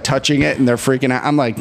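ordinary talk

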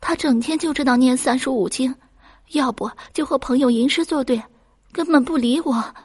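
high, plaintive young wife, lightly acted tears; tearful complaint. A narrator's reading. Second daughter complains her husband ignores her, mildly tearful. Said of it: "二女儿也哭着说".

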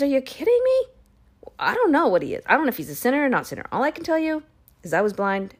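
high, exasperated retort; irritated blind man. Retold or imitated. Healed man scoffing at the leaders, exasperated and pitched high. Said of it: "the man kinda gets a little irritated".

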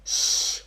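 "sh" alone, no vowel; sh sound. Shown as a demonstration. Teacher showing how ST begins, a lone "sh". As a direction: make a voiceless "sh" on its own. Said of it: "também faz som de".

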